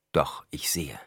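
soft unvoiced whisper on "doch ich sehe". A narrator's reading. Paul tells Stilgar he sees without eyes, whispered. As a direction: breathe it as a secret. Paul's quiet paradox.

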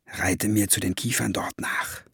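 soft, confidential instruction; hushed murmur. A narrator's reading. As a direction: tell him secretly, keep it down. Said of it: "raunte er ihm zu".

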